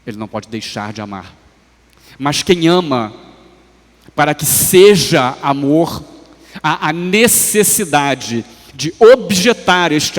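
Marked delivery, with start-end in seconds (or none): 2.2-10.2 loud, slow, weighty sermon delivery; raised pulpit voice, deliberate emphasis; emphatic preaching. Real, not acted. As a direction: stretch out and punch the key words. Preacher builds emphatic point.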